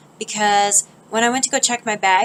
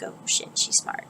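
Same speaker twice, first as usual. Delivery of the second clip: half-whispered, backing off; retold hushed reaction; 6 dB quieter than usual. Thieves realising she is no easy target, mock hushed.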